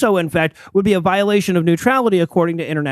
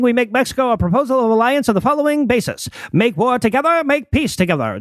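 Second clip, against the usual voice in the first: put-on higher, formal old telegram reading; old-timey voice; 5 semitones higher than usual. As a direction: read it like an old newsreel announcer. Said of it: "in an old-timey voice".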